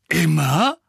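loud booming question. Acted. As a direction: boom the word out in disbelief. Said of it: "dröhnte Madame Bones".

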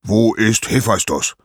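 impatient, angry god; angry demand. Acted. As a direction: demand an answer in acted anger. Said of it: "rief Zeus wütend".

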